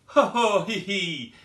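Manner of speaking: hearty spelt-out ho ho hee hee, loud; mock laughter. Knight laughing at a joke in his book, loud mock laughter.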